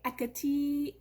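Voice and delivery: "Ekati" with no H, ending in a long ee; H left silent. Shown as a demonstration. Lecturer showing a second Greek dialect form, H left silent. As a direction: say "Ekati" as EK-uh-tee. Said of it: "don't pronounce the H at all".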